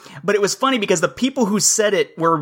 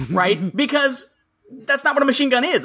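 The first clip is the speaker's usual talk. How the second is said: ordinary delivery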